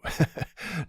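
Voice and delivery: quick high disbelieving laugh; vampire laughing at the hunter's ignorance; incredulous laugh. Acted. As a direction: burst out laughing at the question. Laughs at being asked if human.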